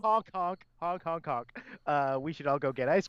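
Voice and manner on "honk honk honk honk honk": silly clown honking. Pretend.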